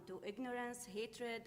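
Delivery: usual speaking voice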